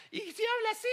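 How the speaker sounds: comically high put-on voice; squeaky devil voice. Preacher jokes the devil might talk differently, high silly voice. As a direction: jump way up into a silly little devil voice. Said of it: "como que siempre le ponemos una voz".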